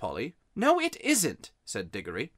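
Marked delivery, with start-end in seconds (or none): 0.6-1.5 put-on high-pitched childish contradiction; boy bickering. A narrator's reading. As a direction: argue back as a stubborn boy.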